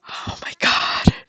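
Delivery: slow, unvoiced whisper; awed whisper. Real, not acted. Gamer watching her villager leave, awed whisper. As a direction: breathe it out in awe. Overwhelmed by the moment.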